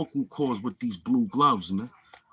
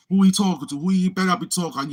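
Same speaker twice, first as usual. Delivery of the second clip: higher, louder mocking comeback; mock indignant; 8 semitones higher, 5 dB louder than usual. Mimicking the troll asking who he means, mock indignant. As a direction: act out an indignant challenge, pitched up and loud. Voices the target's indignant reaction.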